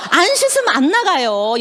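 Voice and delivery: slow, punchy, insistent; emphatic insistence. Real, not acted. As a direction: lay down the rule firmly. Insisting on washing before going out.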